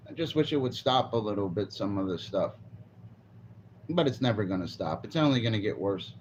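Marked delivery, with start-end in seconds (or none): none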